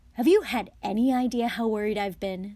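loud, tense reproach; deadly. A narrator's reading. Mother confronts guilty sons, deadly angry. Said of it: "in a deadly whisper".

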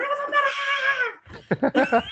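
high, drawn-out mock shriek of a furious woman; hammed-up enraged yell; imitated shout. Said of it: "E ela gritando".

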